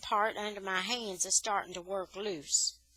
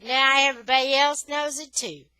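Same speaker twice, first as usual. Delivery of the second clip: loud taunt; 4 semitones higher, 11 dB louder than usual. Acted. J-Boy insults the narrator.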